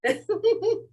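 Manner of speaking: high, light giggle. Real, not acted. Teacher amused by own joke to class, giggling. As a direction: break into a brief high laugh. Laughs after joking about eating pupils.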